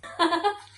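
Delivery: high, loud laugh; burst of laughter. Real, not acted. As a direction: laugh out loud at your own jab. Laughs at own insult. (kusog nga pagkatawa)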